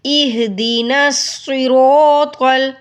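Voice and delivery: slow chant, pressing the ya of وإياك; recite, leaning on the shadda; stressed recitation. Shown as a demonstration. Teacher reciting with the stressed ya. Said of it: "آپ اس کی practice کریں".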